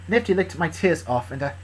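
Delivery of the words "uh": ordinary delivery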